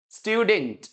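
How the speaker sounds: स्टूडेंट repeated as STYOO-dint; second correct version. Shown as a demonstration. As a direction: drill स्टूडेंट with a 'y' after 't'. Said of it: "या फिर बोलिए". (स्टूडेंट को 'स्ट्यूडिंट' कहना)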